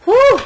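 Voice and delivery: high, loud exhaled whew; sharp loud whew of release; excited whew. Real, not acted. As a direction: blow out the overwhelming energy with a loud high whew. Overwhelmed by reading energy.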